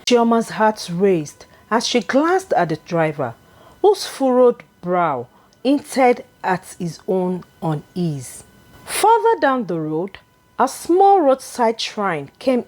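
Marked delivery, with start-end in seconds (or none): none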